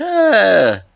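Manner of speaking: a high, loud shout of dismay; loud dismayed cry. Real, not acted. Pack opener groaning loudly at a bad pull, high and loud. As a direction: cry out in frustration. Disappointing card revealed.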